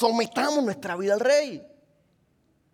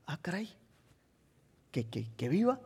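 hesitant king; 3 semitones lower, 10 dB quieter than usual. Retold or imitated. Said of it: "el rey me imagino que hizo".

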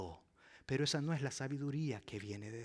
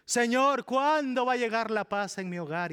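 high, loud desperate plea; mock desperate believer; pleading; 9 semitones higher, 9 dB louder than usual. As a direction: cry out to the Lord impatiently. Congregant pleading to God.

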